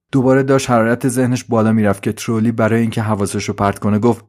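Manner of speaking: ordinary delivery, nothing marked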